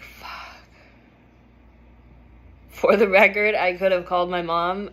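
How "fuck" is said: a breathless whispered fuck, almost no voice; whispered curse. Retold or imitated. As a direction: breathe the word out in dismay, unvoiced. Quiet despair after breaking her face.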